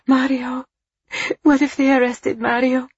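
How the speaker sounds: terrified for her husband; panicked fear. A narrator's reading. Wife fearing for husband.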